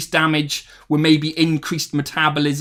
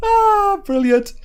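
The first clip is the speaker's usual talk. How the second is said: delighted; 3 dB louder, much slower than usual. Real, not acted. Delighted reaction to chat.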